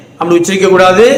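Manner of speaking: ordinary talk, nothing marked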